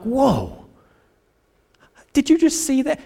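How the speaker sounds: mock wide-eyed astonishment; surprised. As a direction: act stunned at the answer. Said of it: "And I'm like".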